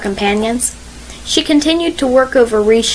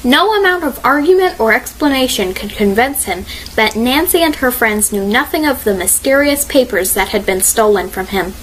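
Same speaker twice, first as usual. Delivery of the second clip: ordinary delivery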